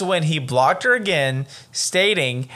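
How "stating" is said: usual speaking voice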